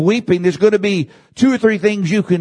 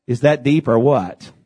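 ordinary delivery

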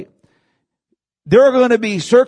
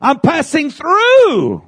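"through" drawn out long, much higher and louder; stress on through; 11 semitones higher, 4 dB louder, much slower than usual. Shown as a demonstration. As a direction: lean hard and slow on "through". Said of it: "underline the word through".

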